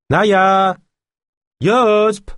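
loud, high calls across the river; shouting names. A narrator's reading. De Lune calls for his missing friends by the river, loud high shout. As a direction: call out for lost friends. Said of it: "cria de nouveau De Lune".

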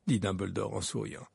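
usual speaking voice

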